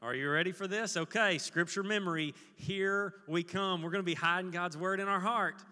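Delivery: ordinary talk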